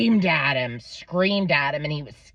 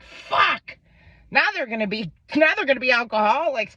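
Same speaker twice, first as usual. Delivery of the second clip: hammed-up alarm over the kids drinking; mock panic; 7 semitones higher than usual. Mom hearing which Smirnoff the boys drank, mock panic. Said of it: "I'm like".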